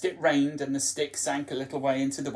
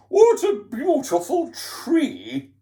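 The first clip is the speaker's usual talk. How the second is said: high, slow, plummy posh voice; posh scarecrow; 11 semitones higher, 7 dB louder, slower than usual. Acted. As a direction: admire the tree like a toff. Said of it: "he sounds like a posh scarecrow".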